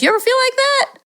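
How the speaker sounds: high-pitched playful aside; mock confiding question. Parent asking watching kids, mock confiding question. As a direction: ask it high, fishing for agreement. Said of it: "You might say, if they're looking at you".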